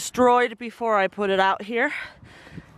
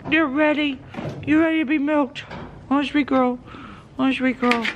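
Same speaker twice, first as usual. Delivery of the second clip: cooing; 5 semitones higher than usual. Real, not acted. Farmer coaxing goats before milking, sweet cooing.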